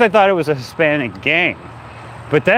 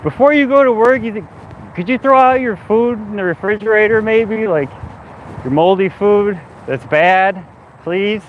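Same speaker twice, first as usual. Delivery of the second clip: overly polite request; 6 semitones higher than usual. Retold or imitated. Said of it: "I'm like".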